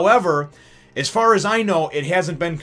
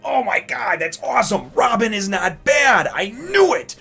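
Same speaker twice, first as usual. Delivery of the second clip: high, loud burst of delight, shouting that Robin isn't bad; overjoyed high cry of I knew it; thrilled fan reaction; 9 semitones higher, 5 dB louder than usual. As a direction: gush like a vindicated fan. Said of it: "for me, it was just like".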